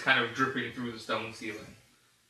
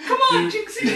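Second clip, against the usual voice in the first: cartoon voice; 8 dB louder than usual.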